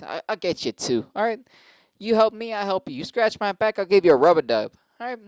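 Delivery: high, loud, pushy sweet-talk; butter him up like an over-eager fixer, pitched high; hammed-up schmoozer. Comic to the guy who runs the mic, hammed-up wheedling. Imitates himself flattering the mic runner.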